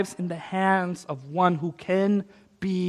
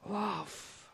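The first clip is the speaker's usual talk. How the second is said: hushed; 19 dB quieter than usual. Acted. Hushed close of sermon climax.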